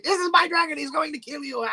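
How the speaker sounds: high, gloating hammed-up villain; Sakura. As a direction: taunt them high, ending in a villain's laugh. Voicing Sakura threatening with dragon.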